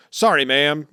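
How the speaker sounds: blurted dutiful apology; nervous apology. Retold or imitated. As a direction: imitate a nervous underling caught out. Voicing nervous Chopper to Big Mom.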